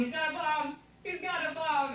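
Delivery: high-pitched excited announcement; excited retelling. Retold or imitated. Excitedly retelling the bomb reveal.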